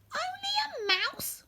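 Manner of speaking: indignant squeak. A narrator's reading. Mouse queen offended by the woodman, high squeak. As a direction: squeal it out, affronted. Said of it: "cried the little animal in indignity".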